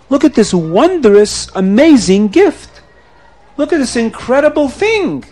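slow, emphatic marveling; excited showing off. Acted. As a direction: stretch out the wonder for everyone. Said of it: "he got so excited".

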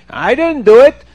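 high, loud protest; outraged "not me" from the accused; indignant denial. Acted. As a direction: fling the blame off loudly. Hotel man denies accusation.

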